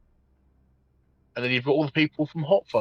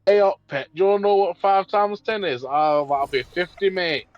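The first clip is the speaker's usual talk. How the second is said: loud, higher-pitched Yorkshire impression; Yorkshire accent; 8 semitones higher, 6 dB louder than usual. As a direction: play a Yorkshire voice assistant, big and loud. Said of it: "that's what Yorkshire people sound like".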